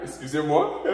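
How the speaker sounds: ordinary delivery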